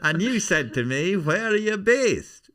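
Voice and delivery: high, loud, insistent Irish comic voice; Tommy Nicholson. Acted. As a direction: pester the star with excited memories. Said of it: "That's Tommy Nicholson".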